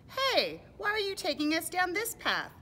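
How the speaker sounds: high, loud objection; acted protest, pitched right up; protesting animal. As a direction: call out in storybook indignation.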